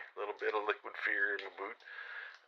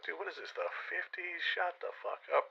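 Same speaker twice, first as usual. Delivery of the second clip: incredulous scoff; 9 semitones higher than usual. Retold or imitated. Narrator mocking the stranger's politics.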